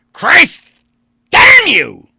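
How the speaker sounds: very high, very loud outburst; furious cry. Acted. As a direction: explode with rage. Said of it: "Vandeleur cried".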